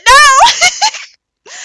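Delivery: high, loud laughing yell; a real laughing outburst; laughing shout. Real, not acted. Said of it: "You said".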